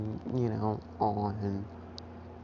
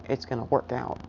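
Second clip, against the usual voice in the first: usual speaking voice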